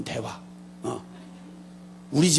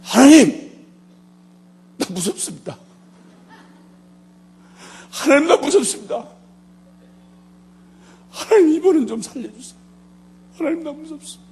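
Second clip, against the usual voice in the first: much higher, pleading inner cry; frightened cry to God; 10 semitones higher, 5 dB louder than usual. Retold or imitated. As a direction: beg God in fear. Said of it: "소리를 지른 거 아니".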